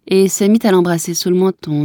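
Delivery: ordinary delivery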